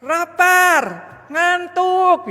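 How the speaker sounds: put-on high, loud hungry child's cry; child yelling. Hungry child shouts at busy mother, high and loud. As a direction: wail it like a hungry, sleepy kid. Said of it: "lalu dia teriak ya".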